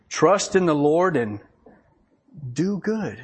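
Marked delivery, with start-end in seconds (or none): none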